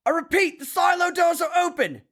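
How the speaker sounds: high, urgent shouted alert; military radio alarm. Acted. Quoting a war game, urgent shouted alert. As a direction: bark the launch warning like a panicked officer.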